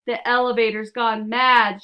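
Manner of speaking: loud frantic cry; alarmed shout. Acted. Grandpa Joe shouting that the elevator has gone mad, loud alarm. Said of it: "shouted Grandpa Joe".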